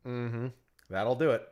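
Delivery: ordinary talk, nothing marked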